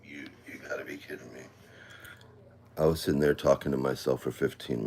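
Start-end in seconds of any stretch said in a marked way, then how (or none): 0.1-1.4 breathless whisper of disbelief; exasperated whisper. Real, not acted. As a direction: hiss it under your breath, fed up. Frustrated muttering to herself.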